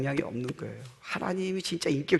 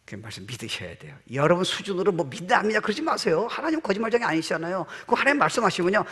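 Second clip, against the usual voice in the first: ordinary delivery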